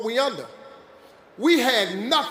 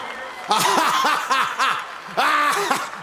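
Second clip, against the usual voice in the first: big triumphant laugh; 4 semitones higher than usual. Real, not acted. Laughing at those who doubted him, full belly laugh. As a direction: laugh it off like you won.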